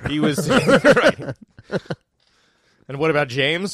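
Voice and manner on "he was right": laughing. Real, not acted. Laughing at a silly joke.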